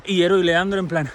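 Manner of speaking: ordinary delivery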